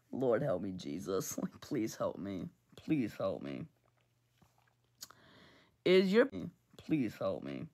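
a low, worn-out prayer for help; exasperated plea. Real, not acted. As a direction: beg for patience, deep and tired.

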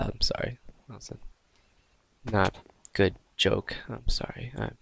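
ordinary talk, nothing marked

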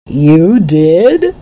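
mildly astonished question, rising at the end; surprised cry. A narrator's reading. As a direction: react with a touch of surprise. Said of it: "cried Doctor Moore".

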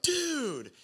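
high, drawn-out mock wail; mock crying kid. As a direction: imitate an upset kid's long, falling whine. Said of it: "he starts crying".

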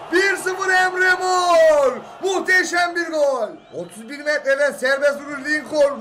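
fast excited play-by-play commentary; turkish football commentator. Acted. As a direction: call the match live like on TV. Live play-by-play of a match.

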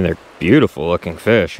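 ordinary talk, nothing marked

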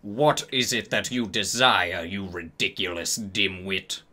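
low, cold contempt in an acted voice; cold, angry. A narrator's reading. Teacher angrily answering a student, cold and low. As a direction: sneer it low and coldly. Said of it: "Snape demeaned angrily in his cold voice".

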